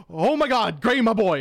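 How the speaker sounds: loud, high proud cry; a proud mentor shouting for the boy who won, loud and high; proud exclaim. Retold or imitated. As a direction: burst out with fatherly pride.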